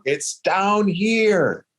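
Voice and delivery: high, long-drawn mock holler, held back in volume; imitated yelling. Hiker waving at the hunter from the bottom, imitated yelling. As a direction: imitate someone hollering up from far below, stretching every word. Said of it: "And he's yelling".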